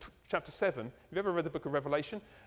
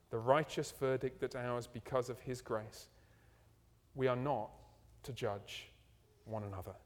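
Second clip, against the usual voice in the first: closing prayer; 4 semitones lower than usual. Acted. Pastor praying for the church after the sermon, reverent.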